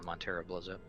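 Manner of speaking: ordinary talk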